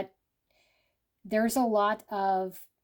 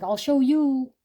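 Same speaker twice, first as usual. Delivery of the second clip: defiant; 4 semitones higher, 6 dB louder, slower than usual. Retold or imitated. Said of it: "It's kind of a little bit of a desperation".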